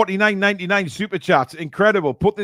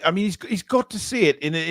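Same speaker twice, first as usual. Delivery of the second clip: usual speaking voice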